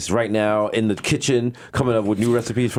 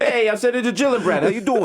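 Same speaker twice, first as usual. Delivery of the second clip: high, hammed-up New York accent; mock New Yorker greeting; Senator Gillibrand; 8 semitones higher than usual. Senator saying hello, in an exaggerated New York accent. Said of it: "us doing a New York accent".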